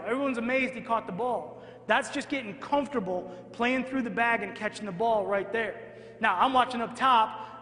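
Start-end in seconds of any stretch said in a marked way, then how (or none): none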